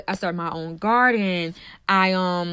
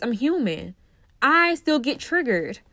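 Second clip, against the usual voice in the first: normal talking voice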